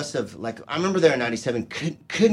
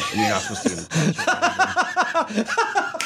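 laughing through words; 13 semitones higher, slower than usual. Real, not acted. Laughing at royal protocol.

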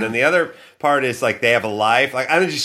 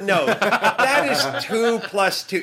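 high, slow mock-exasperated spelling out; retold exasperated correction; 9 semitones higher, much slower than usual. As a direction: hammer it out slowly in mock disbelief.

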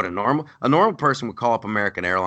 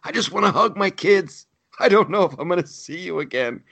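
mock tearful Comey; 9 semitones higher than usual. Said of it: "He's just".

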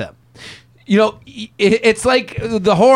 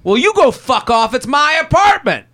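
mock angry retort; 7 semitones higher than usual. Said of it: "looks at you and goes".

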